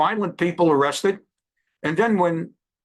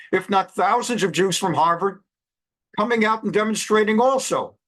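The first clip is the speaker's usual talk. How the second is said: ordinary talk, nothing marked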